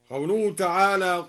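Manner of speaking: ordinary talk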